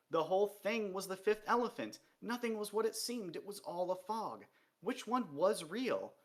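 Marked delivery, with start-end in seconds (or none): none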